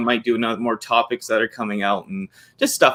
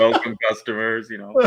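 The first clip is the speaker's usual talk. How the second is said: Soundwave voice. Retold or imitated. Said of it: "I always feel like Soundwave".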